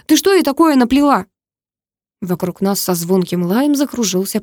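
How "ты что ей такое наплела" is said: high, heated interrogation; angry demand. A narrator's reading. Brother shakes sister and demands answers, high angry voice.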